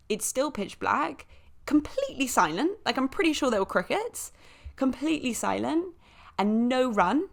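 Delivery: usual speaking voice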